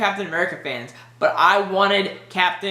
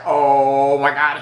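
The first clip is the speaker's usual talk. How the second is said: slow, amazed, a bit louder; awed oh my God; 3 semitones lower, 4 dB louder, much slower than usual. Retold or imitated. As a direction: let the realization hit you. Realizing he is Iron Man.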